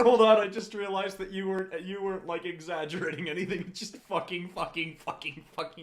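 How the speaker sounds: high, loud laughing disbelief. Real, not acted. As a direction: crack up while talking. Breaks up laughing at story.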